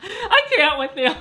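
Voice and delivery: laughing out loud; cracking up at the joke. Real, not acted.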